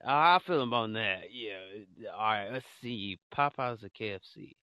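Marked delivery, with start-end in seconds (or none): 0.1-1.2 I feel him on that, said louder with stress on I; louder agreement. Real, not acted. Agreeing with his cohost, louder and emphatic. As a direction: repeat it louder and firmer.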